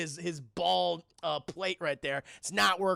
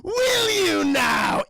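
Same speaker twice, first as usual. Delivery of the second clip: high, loud, drawn-out villain voice; Joker; 12 semitones higher, 10 dB louder, much slower than usual. Acted. The driver looks over at Charlie, gleefully menacing. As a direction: taunt theatrically, stretching each word. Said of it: "It's the Joker".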